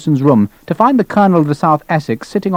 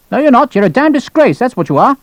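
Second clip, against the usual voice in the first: high-pitched, indignant rebuke; angry colonel; 10 semitones higher, 3 dB louder than usual. Acted. Colonel calling lieutenant a disgrace, angry. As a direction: scold in a high, outraged voice.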